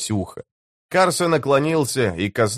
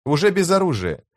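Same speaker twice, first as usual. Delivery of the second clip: ordinary delivery, nothing marked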